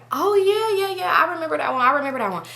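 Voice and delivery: high, drawn-in "oh yeah" of sudden recall; playing along. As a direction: act like you suddenly remember. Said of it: "like, go along with it".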